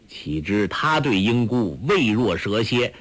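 ordinary delivery, nothing marked

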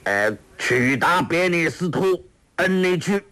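a lightly voiced old villain chanting gibberish; reading strange scripture. A narrator's reading. Ouyang Feng reads the fake scripture aloud, haltingly. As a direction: sound out the foreign words haltingly. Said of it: "欧阳锋说".

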